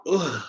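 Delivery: short groan of disgust at the liquor. Real, not acted. Dreading the shot of Crown Apple, a disgusted groan. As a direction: groan at the smell of the drink.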